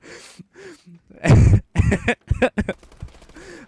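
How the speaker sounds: long, loud, high laughter; real laughing fit. Real, not acted. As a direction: crack up helplessly at your own story. Laughing at his own punchline.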